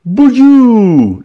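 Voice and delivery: put-on cri aigu et fort avec l'accent du Midi; exclamation méridionale. A narrator's reading. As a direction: lancer Boudiou très haut comme un paysan provençal. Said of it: "lança monsieur Pichouline". (juron du Midi crié, aigu)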